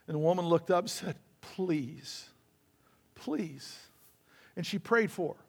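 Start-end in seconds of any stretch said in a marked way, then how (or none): 1.4-3.8 softly begging please; a hushed, desperate please; quiet pleading whisper-soft. Retold or imitated. Injured woman begging for prayer.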